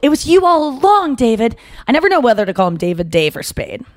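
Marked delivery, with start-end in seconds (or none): 0.0-1.6 mock revelation. Retold realization to Dave.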